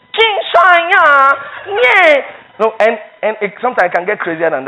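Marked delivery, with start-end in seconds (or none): 0.1-2.6 high mocking shout of a passerby; loud, high jeering call; mock outsider shout. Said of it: "you were passing by, you say".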